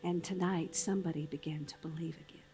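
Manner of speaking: low, hushed inspirational close. Acted. Closing the show for the audience, hushed and inspiring.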